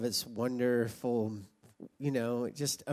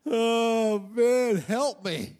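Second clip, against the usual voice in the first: higher, slow, mock plea; pleading young self; 9 semitones higher, 7 dB louder, much slower than usual. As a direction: beg for help in a comic teenage whine. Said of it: "they were like".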